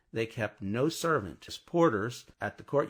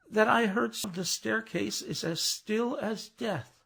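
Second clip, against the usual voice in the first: mild falter, much higher, slowing at the end; 11 semitones higher than usual. A narrator's reading. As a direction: let the words waver a little. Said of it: "in a faltering voice".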